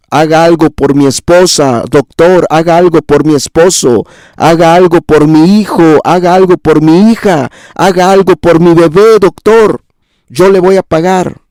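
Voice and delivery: high-pitched, insistent imitation of begging relatives; tearful pleading, acted. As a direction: plead with the doctor, pitch climbing without real crying. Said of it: "Le suplican con lágrimas en los ojos". (súplica insistente actuada)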